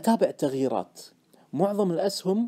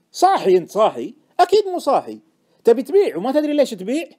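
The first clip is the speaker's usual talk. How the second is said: loud, rising incredulous outburst; exasperated scolding; 6 semitones higher, 7 dB louder than usual. Acted. Friend scolding the trader for selling blindly, exasperated. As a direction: berate him as if he's lost his senses.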